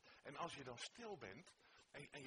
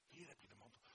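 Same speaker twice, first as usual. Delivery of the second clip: much softer echo of the offer; soft repeat; 3 semitones lower, 10 dB quieter, much faster than usual. Acted. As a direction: hush it on the repeat. (zachtjes herhaald)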